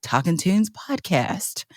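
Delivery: podcast intro voice. Real, not acted. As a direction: ease the listeners in softly, stretching the key words. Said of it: "Why are you talking like that?".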